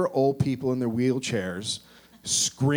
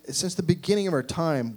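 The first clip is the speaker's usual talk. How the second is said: normal talking voice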